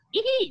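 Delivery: very high taunting titter; imitated sneering laugh; mocking giggle. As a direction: giggle to mock the opponent. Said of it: "dia gelak kan. Gelak yang menganjing".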